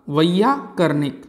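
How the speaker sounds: वैयाकरणिक, slow, each syllable clear, starting with "vai"; correct pronunciation. Shown as a demonstration. Teacher showing the class the correct word, slowly. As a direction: draw out वैयाकरणिक carefully, beginning with "vai". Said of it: "व्याकरण को जानने वाला".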